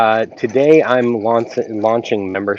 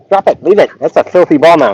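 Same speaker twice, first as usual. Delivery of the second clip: high, loud dog command; firm call to make the dog let go; 7 semitones higher, 7 dB louder than usual. Real, not acted. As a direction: owner ordering the dog to drop the ball.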